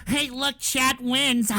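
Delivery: put-on gloating voice into fake laughter; mocking chatters. Streamer imitating chat thinking it has won, mocking laugh. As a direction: crow it, then jeer with laughter. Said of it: "flapping their fat Cheeto stained fingers ... thinking".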